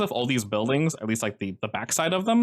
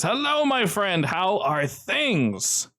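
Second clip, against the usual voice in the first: high, slow friendly greeting; Joe's greeting; 9 semitones higher, much slower than usual. Acted. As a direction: stretch it out, warmly high. GM voices Joe greeting informant.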